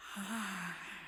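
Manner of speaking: faint drawn-out exhale; heavy sigh. Acted. Queen sighing before venting to her champion, soft heavy sigh. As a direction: sigh before starting to vent. Unsure where to begin.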